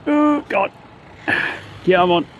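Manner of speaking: strained voice lifting something heavy; carrying heavy load. Real, not acted. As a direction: talk while hauling a heavy weight. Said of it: "It weighs a ton".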